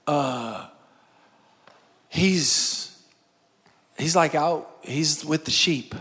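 low, halting, sheepish answer; reluctant father. Acted. Jesse telling Samuel where the youngest is, reluctant. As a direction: drag it out softly with long hesitations.

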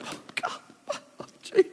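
hammed-up child's sob, voice breaking; mock crying. Boy home from kindergarten, mock sobbing to himself. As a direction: wail it like a heartbroken little kid. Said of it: "I'm crying".

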